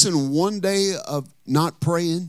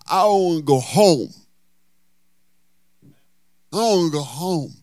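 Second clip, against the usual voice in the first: stroke-impaired speech; 3 semitones higher, 5 dB louder than usual. Retold or imitated. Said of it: "he couldn't talk well".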